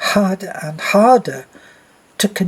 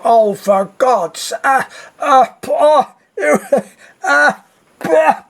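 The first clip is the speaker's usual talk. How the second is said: high-pitched comic cries of revulsion; mock gagging disgust; 9 semitones higher, 4 dB louder, slower than usual. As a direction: act out a crowd choking on a stink. Moviegoers gagging at worse stench.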